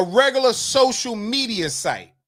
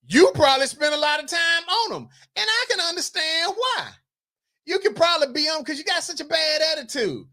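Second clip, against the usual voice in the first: higher, sharp retort at a rude commenter; annoyed comeback; 7 semitones higher than usual. Real, not acted. Host answering Nikki Carter's dig with rising irritation. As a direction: raise the pitch and fire back, irritated.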